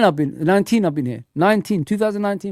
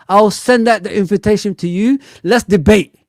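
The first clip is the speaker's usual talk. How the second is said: ordinary talk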